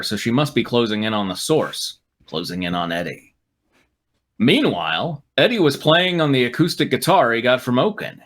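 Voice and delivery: usual speaking voice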